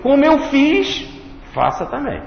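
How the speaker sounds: high, emphatic urging. Retold or imitated. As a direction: rise up and urge them to follow. Voicing Jesus, urging to follow.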